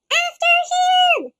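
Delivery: high, loud charge; shouted cry. Acted. The trio shouting to chase Monster. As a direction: yell the charge. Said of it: "the trio cried".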